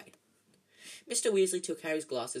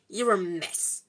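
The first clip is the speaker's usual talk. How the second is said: gruff, louder scolding; 2 semitones higher, 5 dB louder, slower than usual. A narrator's reading. Hagrid tells off a sooty Harry, gruffly. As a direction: scold with rough bluntness. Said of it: "said Hagrid gruffly".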